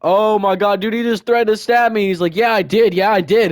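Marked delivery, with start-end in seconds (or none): 0.0-2.1 loud, high-pitched outburst of disbelief; mock shock. Telling the class about a pencil threat, loud mock shock. 2.3-3.5 loud, high, defiant retort; imitating someone mad. Classmate owning up to his threat, imitated loud anger. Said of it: "getting really mad".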